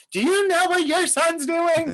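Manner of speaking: high, slow, shrill-free mock mom voice; nosy Italian mother. Neighbor mother tattling to his mom, high mock voice. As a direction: mimic a scandalized neighbor mother. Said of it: "one of them was like".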